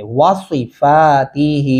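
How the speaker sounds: वासीफातिही slowly with heavy "swee"; slow recited वासीफातिही, emphatic S; rounded Saad. Shown as a demonstration. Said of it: "ये खास ध्यान रखना है".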